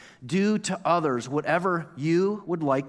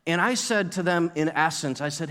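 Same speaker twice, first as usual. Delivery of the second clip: usual speaking voice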